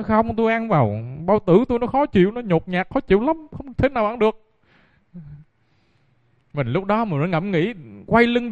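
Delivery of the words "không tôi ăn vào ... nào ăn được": higher, pleading complaint; lightly voiced whiny protest; complaining husband. Husband pleading with the young monk, a touch of whining.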